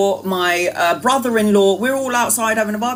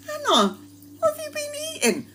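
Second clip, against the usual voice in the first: much higher, softer squeaky teasing question; mock family high voice; 6 dB quieter than usual. Relatives asking Hannah about the smell, high mock voice. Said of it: "they were like".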